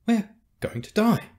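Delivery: high, loud cry of doom; panicked. A narrator's reading. Beta to companions caught in spotlights, panicked. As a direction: let the pitch leap in alarm.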